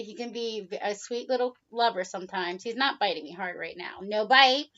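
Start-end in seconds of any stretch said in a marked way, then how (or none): 4.0-4.7 loud high scolding no bite; stern command to a pet; firm no. Real, not acted. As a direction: tell the bird off sharply. Scolding the biting bird.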